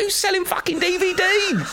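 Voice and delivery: high, disbelieving outburst; can't believe what you're hearing; incredulous. Retold or imitated. Incredulous inner reaction.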